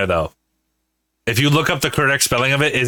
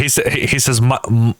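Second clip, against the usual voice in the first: ordinary talk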